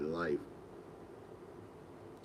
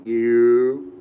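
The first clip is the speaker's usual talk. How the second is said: much louder, punched single word; surprise wake-up call; 3 semitones higher, 13 dB louder than usual. Retold or imitated. Waking the sleeping friend as a birthday surprise, loud call. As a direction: jolt him awake with it.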